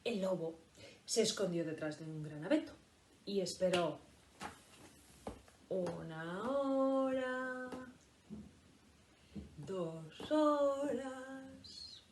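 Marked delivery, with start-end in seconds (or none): 5.7-12.0 very slow, long drawn hours; dragging time. A narrator's reading. Teacher makes the wolf's waiting feel endless, very slow. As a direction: stretch each hour out.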